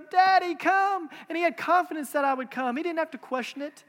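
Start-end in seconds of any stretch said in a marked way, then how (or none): 0.1-1.1 high, slow, louder plea; child's plea. Retold or imitated. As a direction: call Daddy slowly like a hurting boy. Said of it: "was to go".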